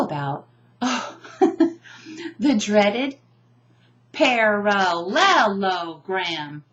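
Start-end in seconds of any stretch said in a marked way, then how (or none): 4.1-6.6 "parallelogram" very slowly, pa-ra-LEL-o-gram; "parallelogram" in drawn-out chunks, middle LEL punched; broken into syllables. Shown as a demonstration. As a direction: stretch "parallelogram" beat by beat, big stress on LEL. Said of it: "but it chunks it. It kind of breaks it down".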